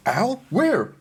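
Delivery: high, loud alarmed owl; startled owl. Acted. Owl Alfonso hearing the kid say owl, high alarmed question. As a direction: spin around in alarm.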